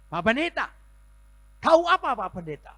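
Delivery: high, loud, furious cry of a hurt woman; angry outburst. Retold or imitated. Sick woman lashing out at pastor, high and loud. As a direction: lash out at the pastor. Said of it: "langsung dia marah".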